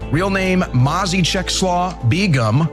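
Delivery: usual speaking voice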